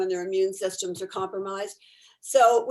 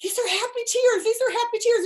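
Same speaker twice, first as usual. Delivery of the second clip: very high retold tearful squeal; imitated happy crying; 13 semitones higher, 3 dB louder than usual. Crying girl reassuring the dog's owner, high mock-tearful. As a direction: imitate someone overcome, pitched way up. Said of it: "she kept crying and crying".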